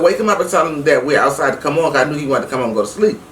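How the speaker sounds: normal talking voice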